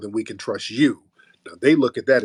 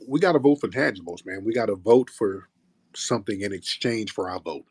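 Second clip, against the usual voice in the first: normal talking voice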